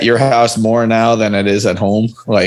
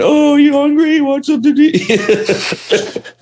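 put-on very high, slow baby talk to a cat; slower than usual. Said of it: "it's like in cat voice".

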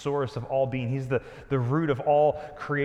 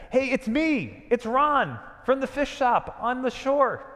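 cheery ordinary guy; 11 semitones higher, 5 dB louder, slower than usual. Acted. Ordinary guy Ron calling out.

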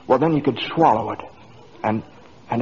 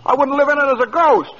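incredulous; 12 semitones higher, 5 dB louder than usual. Acted. Incredulous at her wish.

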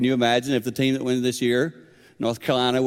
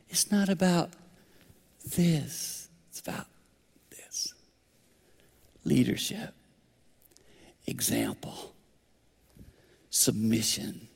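whispered, much softer, slow; dramatic whisper; 2 semitones higher, 8 dB quieter, slower than usual. Real, not acted. Preacher hushing the congregation to drive it home. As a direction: drop to a whisper to make the point. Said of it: "preacher drops to a whisper for emphasis".

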